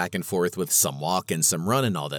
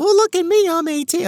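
high, mocking squeaky teen; 5 dB louder than usual. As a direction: parody a smug eighteen-year-old, lightly. Mocking eighteen-year-old runners.

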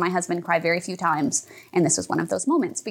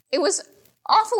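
voice breaking; 11 semitones higher than usual. Real, not acted. Recalling the fear.

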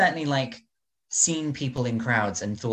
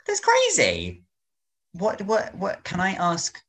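amazed; 8 semitones higher, slower than usual. Real, not acted. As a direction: gasp it out as if you can't believe it. Reacts to niece story.

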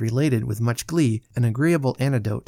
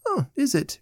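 high, offhand little question; careless; 14 semitones higher than usual. A narrator's reading. Allen answers his friend at breakfast, careless. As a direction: ask it airily, as if it hardly matters. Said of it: "inquired Mister Allen carelessly".

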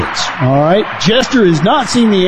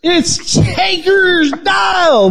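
high, slow hyped introduction; announcer shout; 14 semitones higher, much slower than usual. Acted. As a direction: call the name like a ring announcer. Introducing the guest.

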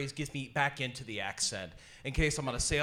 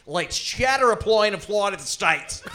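broad Australian twang, higher and louder; exaggerated Aussie accent, pitched up; 6 semitones higher, 7 dB louder than usual. Shown as a demonstration. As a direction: belt it out in a hammy Australian accent.

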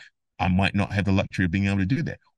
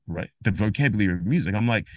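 ordinary talk

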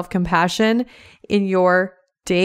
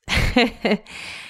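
light, higher-pitched little laugh; short chuckle; 4 semitones higher than usual. Real, not acted. Host amused after announcing the episode, short chuckle. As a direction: chuckle briefly to yourself.

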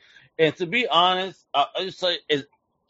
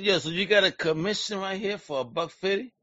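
ordinary delivery

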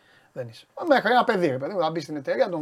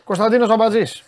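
name called out loud and higher; 5 semitones higher, 9 dB louder than usual. Real, not acted. Host hailing Konstantinos Ampatzis by name, loud call-out. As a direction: boom the name out.

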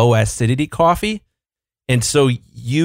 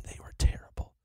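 whispering; 15 dB quieter than usual. Real, not acted. Complaining about bad coffee, whispered.

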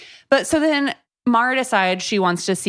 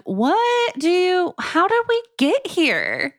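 much higher and slow, mock bewildered; baffled disbelief; 10 semitones higher, slower than usual. Host reacting to the book's plot, high, slow and bewildered. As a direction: stretch out the disbelief as if lost.

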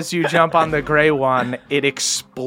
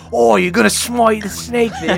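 a hint of a put-on Australian accent, higher; mock Australian accent; 7 semitones higher than usual. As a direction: Luna teasing in a bad Australian accent. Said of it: "They should have made Luna Australian".

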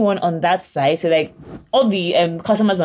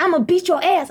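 retold screaming; 10 semitones higher than usual. Friend retelling a stranger's threat, high mock scream. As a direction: mimic a ranting stranger's threat. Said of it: "Then he starts screaming".

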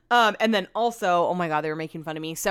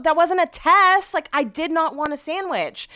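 high-pitched, indignant insistence; worked-up mock outrage; exasperated protest; 9 semitones higher, 4 dB louder, slower than usual. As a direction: re-enact the squabble, voice pushed up. Retold quarrel over unwanted sandwich.

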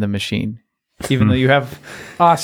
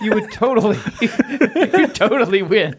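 laughing; 9 semitones higher than usual. Real, not acted. Laughing through teasing praise.

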